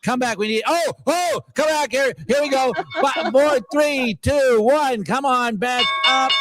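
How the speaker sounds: high, urgent countdown pleading for a rebound; excited countdown. Real, not acted. Trader counting down to the close, high and urgent. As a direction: count down the final seconds, begging.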